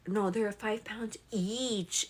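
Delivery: ordinary talk, nothing marked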